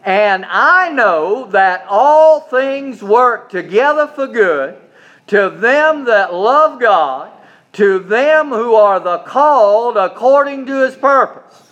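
slow, loud, emphatic scripture reading; reading scripture aloud, stressing I; congregational reading aloud. Shown as a demonstration. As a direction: lead the room through the verse. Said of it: "Are you ready?".

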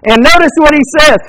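usual speaking voice

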